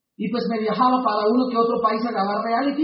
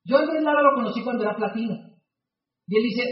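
usual speaking voice

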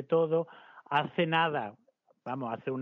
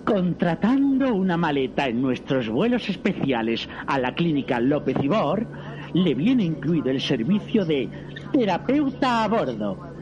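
automated airline announcement; 6 dB louder than usual. Acted. Automated airline service pitch.

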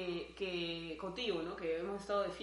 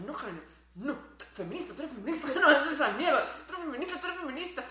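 laughing self-scolding, higher pitched; inner voice; 6 semitones higher, 6 dB louder than usual. Retold or imitated. Telling herself at the party to stay feminist, in a laughing mock scold. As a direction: scold yourself mid-laugh not to get jealous. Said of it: "y mientras pasaba yo decía".

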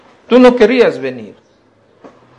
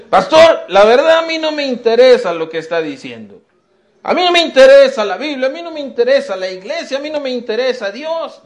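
mocking a bored youth, pitched higher; dismissive young man's voice, raised pitch; imitating an uninterested young man; 7 semitones higher than usual. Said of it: "Tú dices". (joven desinteresado, imitado con voz más aguda)

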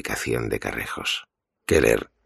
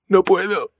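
high, loud pained refusal; anguished cry; 8 dB louder than usual. Acted. Mikey refuses Keller's demand for a name, crying out. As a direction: cry out that you can't.

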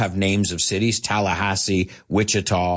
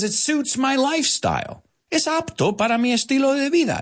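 voicing Bill Murray.

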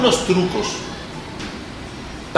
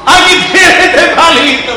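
high, loud, slowed mock hilarity; dying of laughter; 10 semitones higher, 7 dB louder, slower than usual. Shown as a demonstration. Teacher demonstrates the palito game, muerto de la risa, hammed-up laughing voice. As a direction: say it as if cracking up. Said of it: "muerto de la risa".

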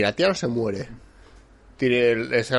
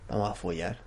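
much softer, sly proposition; hushed come-on; 3 semitones lower, 14 dB quieter than usual. Retold or imitated. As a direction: drop it right down, suggestive. (propuesta susurrada, mucho más suave)

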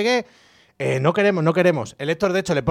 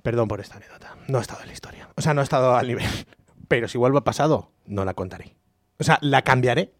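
usual speaking voice

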